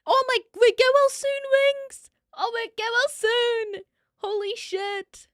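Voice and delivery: high, loud gasping exclamation; shocked concern. Real, not acted. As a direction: cry out get-well wishes in real alarm. Shocked well-wishes to a raider.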